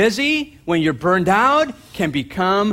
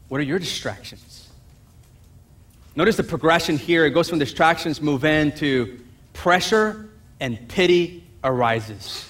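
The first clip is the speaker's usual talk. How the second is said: ordinary talk, nothing marked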